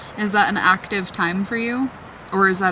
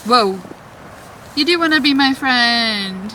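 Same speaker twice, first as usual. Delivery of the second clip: excited; 4 semitones higher, 5 dB louder than usual. Real, not acted. Light on the ghost meter hits red.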